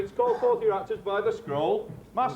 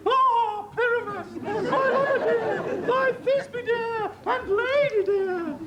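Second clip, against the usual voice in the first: monstrous little voice; 13 semitones higher than usual. Pretend. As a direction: squeak it out like a tiny dainty maiden. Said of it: "I'll speak in a monstrous little voice".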